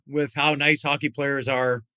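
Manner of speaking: normal talking voice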